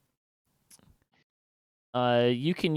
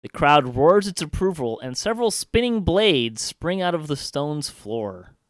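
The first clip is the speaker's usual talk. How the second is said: loud, raised dramatic scene setting; game master narration; 4 semitones higher, 8 dB louder than usual. A narrator's reading. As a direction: narrate the arena spectacle at full volume.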